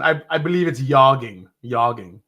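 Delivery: yogging, with a soft J like a Y; yogging with a Y sound. Shown as a demonstration. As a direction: say yogging as yog-ging, Y for the J. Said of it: "soft J".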